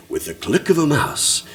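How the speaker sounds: lightly hammed-up ad announcer; mock ad voice. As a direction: mimic a cheesy promo line. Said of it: "an ad or a promotional thing".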